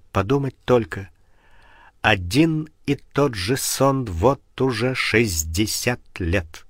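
plain reading voice

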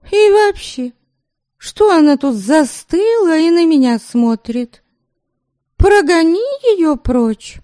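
high, spoiled and disdainful; snobbish mistress. Acted. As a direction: look down your nose at her. Mistress voice held.

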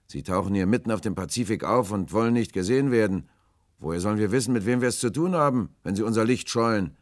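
loud, high remonstrance; challenging sailor. A narrator's reading. Will reasons with the shy stranger, loud and challenging. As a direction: call it reproachfully across the water.

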